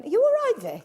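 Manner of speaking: high, loud imitated concern; imitating Alan checking on her; mock concern. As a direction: call out like a worried colleague. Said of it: "Alan kept going".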